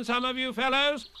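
lightly voiced high-pitched Rabbit; Rabbit calling out. A narrator's reading. Rabbit to the other fellows, calling out.

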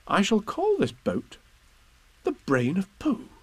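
christen the boat with pride; naming the boat grandly, a small boy's ceremony; proud Christopher Robin. Acted.

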